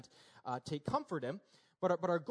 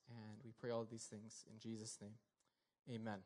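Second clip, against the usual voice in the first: soft, hushed prayer voice, softer throughout; 5 semitones lower, 6 dB quieter than usual. Acted. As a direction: pray quietly and humbly to God. Pastor opens with prayer.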